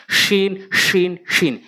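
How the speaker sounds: শিন with a full sh from mid-tongue; articulated sheen. Shown as a demonstration. Teacher drills learners on the letter, separate clear শিন. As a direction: spread a clear sh into each শিন. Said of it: "জিহ্বার মাঝখান থেকে".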